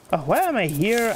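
ordinary delivery

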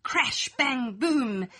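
ordinary delivery

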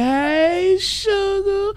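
high, sing-song falsetto "Hey, sugar"; sugary falsetto greeting. Acted. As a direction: coo it up high like a sweet-talker. Signature greeting to the chat.

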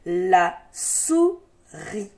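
"La souris" very slow, split la - sou - ris; syllable by syllable. Shown as a demonstration. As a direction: sound out "La souris" with a gap between syllables. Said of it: "des syllabes qui se donnent la main".

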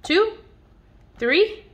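put-on reading to a child, counting balls together. Shown as a demonstration. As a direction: count each ball up eagerly. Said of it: "How many balls are there?".

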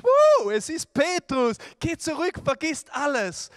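high, loud burst of joy as Rhoda; thrilled servant girl shouting that Peter is at the door; excited girl. Acted. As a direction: gasp it out like a girl who can't believe her ears. Rhoda voiced hearing Peter.

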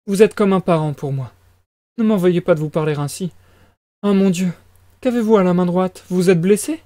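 high-pitched flustered mother; fretful mother character. Acted. Voices the mother.

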